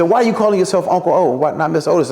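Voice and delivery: high, quick imitation of Martin; Martin asking. Martin asks why he calls himself Uncle O, high imitation. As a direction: ask it fast and high as Martin. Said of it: "And he said".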